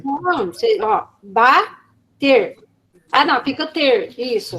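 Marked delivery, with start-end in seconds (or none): 1.2-2.6 BA, long pause, TER; very slow, separated syllables; syllable demonstration. Shown as a demonstration. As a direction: break the word into two slow syllables. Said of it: "manipulação silábica".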